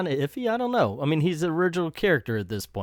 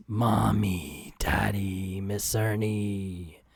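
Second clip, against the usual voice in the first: low, slow, drawn-out villain voice; menacing sing-out greeting; creepy Ernie; 5 semitones lower, much slower than usual. Acted. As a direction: draw each word out low and slow. Voicing Ernie greeting the family.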